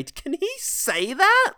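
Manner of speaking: high, drawn-out mock disbelief; scandalised audience member. Audience turning to each other in the cinema, mock-shocked question. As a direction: squeal it slowly, aghast. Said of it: "turn each, to each other and say".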